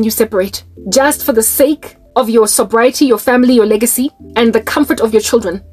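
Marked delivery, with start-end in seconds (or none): none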